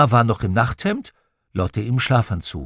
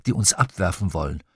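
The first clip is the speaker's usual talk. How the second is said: usual speaking voice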